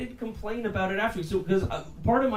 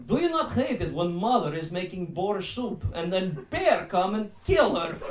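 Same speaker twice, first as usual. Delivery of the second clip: mock Russian-accented comic; deadpan joke in a put-on Russian accent. Imagined Russian stand-up comic tells a joke, Russian accent. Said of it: "what Russian stand-up comedy looked like".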